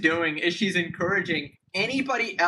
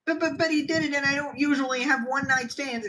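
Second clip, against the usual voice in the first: higher mock whine; mock accuser voice; 5 semitones higher than usual. Mocking an opportunist accuser's excuse in a higher voice. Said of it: "They're trying to come out with their stories".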